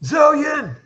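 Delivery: high-pitched call for a missing companion. Acted.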